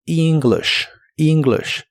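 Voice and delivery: "English" with a long ee start and a short i; "English" con i larga y corta; pronunciation demo. Shown as a demonstration. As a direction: stretch the first vowel of "English". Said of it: "La E se pronuncia como una I larga".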